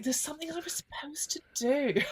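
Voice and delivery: soft unvoiced whisper, half-joking; whispered ghost fretting. A ghost whispering about an unfinished task, soft whisper. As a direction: breathe it like a restless ghost. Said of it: "So it's like".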